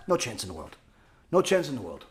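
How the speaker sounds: dropped almost to a whisper, certain; hushed. Real, not acted. Preacher to listeners, hushed and final. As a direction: let the words sink low and final.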